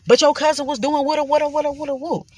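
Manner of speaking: squeaky gabbled quote; high mumbling mimic. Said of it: "He's like".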